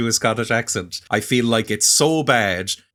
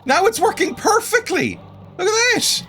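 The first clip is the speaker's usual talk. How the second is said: much higher, thrilled cry of joy; suddenly thrilled the weapon works; excited delight. Real, not acted. Gamer delighted the bow finally works, excited high cry.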